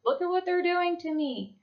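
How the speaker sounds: high, whiny mock complaint. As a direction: whine like a seller who feels cheated. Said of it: "be like".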